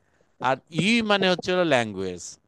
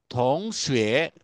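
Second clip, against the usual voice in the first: থংশুয়ে said slowly with a rise; slow vocabulary model; 3 semitones lower, much slower than usual. Shown as a demonstration. Teacher slowly pronounces the new word for students. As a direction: draw out থংশুয়ে, lifting at the end. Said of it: "থংশুয়ে মানে হচ্ছে".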